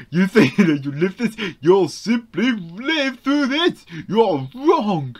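lightly voiced taunting villain; mock the player like a gloating villain. Voicing the game villain.

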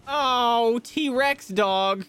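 loud, high drawn-out cry of alarm; dismayed outcry. Real, not acted. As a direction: exclaim it high and loud, stretching the oh. Startled by T-Rex dog in game.

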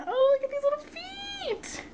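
very high delighted coo; excited squeal. Real, not acted. Crafter spotting the box's little feet, excited squeal. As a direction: squeal high over something tiny. Spots tiny feet on gift.

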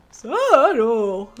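put-on slow loud old grandpa; old man voice. Shown as a demonstration. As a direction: play it as a creaky grandpa. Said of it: "grand-père Simpson".

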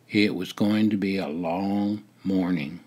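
plain reading voice

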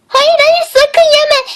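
high, urgent cry to stop her; panicked no. Acted. Friend begs her not to eat the mushroom, panicked. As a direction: yelp in alarm to stop her eating.

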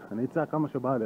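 ordinary talk

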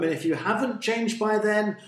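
loud, high proclamation of doom; warning. A narrator's reading. Jonah warning Nineveh of destruction, loudly. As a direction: call out the threat with full force. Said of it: "he warned them".

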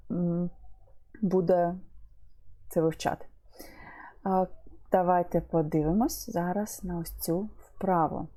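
normal talking voice

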